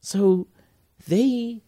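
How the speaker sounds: high, loud, slow auntie voice; imitating the auntie. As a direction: mimic a concerned auntie. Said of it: "her auntie ju-, just going".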